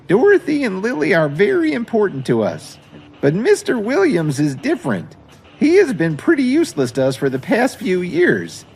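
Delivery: put-on high, fussy old lady voice; a proud grandmother who won't believe the worst; elderly grandmother. Grandma Jones pressing the officer about the kidnapping, high and fussy. Grandma dismisses Kevin's life.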